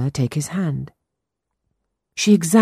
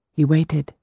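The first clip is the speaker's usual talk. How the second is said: ordinary delivery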